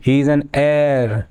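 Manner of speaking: heir to the throne, drawn out word by word; slow model reading. Shown as a demonstration. Teacher reading the phrase to the class, very slow. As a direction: read it very slowly so the class hears "heir". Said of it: "slow model reading".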